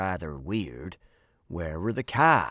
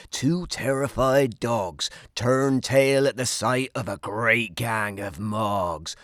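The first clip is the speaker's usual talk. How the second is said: ordinary delivery, nothing marked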